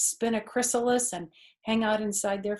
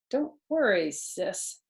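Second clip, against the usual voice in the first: ordinary delivery, nothing marked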